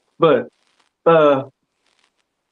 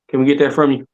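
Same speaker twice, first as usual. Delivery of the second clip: ordinary talk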